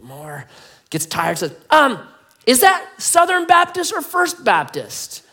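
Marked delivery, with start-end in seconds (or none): 1.7-5.2 high, loud, slow acted question; probing rescuer. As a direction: call down a drawn-out, hammed-up query. Rescuer calls another doubting question.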